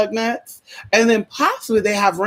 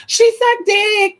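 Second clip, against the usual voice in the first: mocking friend; 14 semitones higher, 4 dB louder, slower than usual.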